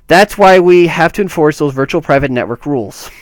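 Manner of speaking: lifted, deliberate reading voice; quote read-aloud. Acted. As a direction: read the statement out with firm emphasis. Reads a quoted statement aloud.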